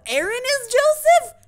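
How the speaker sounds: loud, high squawk of surprise; astonished outburst. Real, not acted. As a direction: blurt it in shrieking disbelief. Astonished to learn who voices Joseph.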